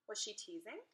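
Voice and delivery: plain reading voice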